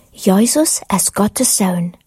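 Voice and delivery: plain reading voice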